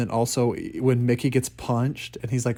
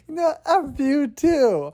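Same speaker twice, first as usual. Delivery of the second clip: defiant retort; 12 semitones higher, 4 dB louder, slower than usual. Retold or imitated. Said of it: "He's like".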